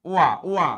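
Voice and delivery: ওয়া, ওয়া, a clear w glide, said twice; w sound demo. Shown as a demonstration. Teacher drilling students on the w sound. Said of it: "ওয়া এর মতো হবে".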